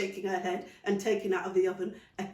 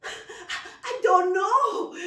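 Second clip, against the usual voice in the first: laughing; 12 semitones higher, 9 dB louder, much slower than usual. Acted. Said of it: "laughed Elizabeth".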